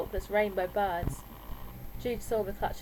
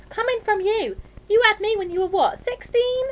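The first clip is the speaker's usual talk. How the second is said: high, loud comeback; outraged teenage accusation; indignant retort; 13 semitones higher, 9 dB louder than usual. A narrator's reading. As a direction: throw it back at her, pitch soaring. Daughter throws it back at mum.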